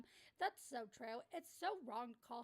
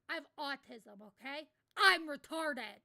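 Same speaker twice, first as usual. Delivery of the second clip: loud blunt declaration; 12 dB louder, slower than usual. Real, not acted. Blunt self-declaration to viewers.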